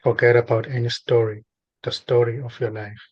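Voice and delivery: spaced-out phrases with long silences between; guided meditation. Real, not acted. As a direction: guide a listener gently into stillness, unhurried.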